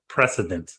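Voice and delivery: "precedent" said low with a clear s, PRESS-ih-dent; correcting pronunciation. Shown as a demonstration. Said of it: "you saying president".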